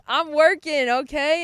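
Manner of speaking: loud, high, emphatic retold comeback; insistent retort. Student defending herself to professor before classmates, loud insistent retort.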